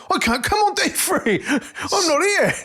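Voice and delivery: laughing high-pitched imitation of a friend; mocking laughing voice. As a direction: mimic him through giggles. Mocking friend turning up wrong day.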